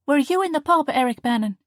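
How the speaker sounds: teasing scold to her brother; mock stern. A narrator's reading. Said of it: "she asked with mock sternness".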